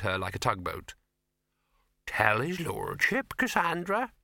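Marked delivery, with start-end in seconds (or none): 2.1-4.1 slow, stately housekeeper's order. A narrator's reading. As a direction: instruct the maid, lifting high on the name. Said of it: "she ordered".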